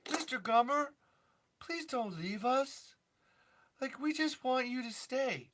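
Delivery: high, small begging voice; high-pitched mock plea; pleading. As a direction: beg the hero to stay, acted. Acted plea to Mister Gummer.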